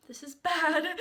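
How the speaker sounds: laughing dismay. Retold or imitated. Customer realizing she's running late, laughing dismay.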